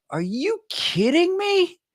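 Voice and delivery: high, slow, drawn-out are you kidding me; disbelief. Retold or imitated. Telling the podcast co-host about the dentist, aghast and high. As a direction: pitch it way up in stunned disbelief. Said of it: "And I'm like".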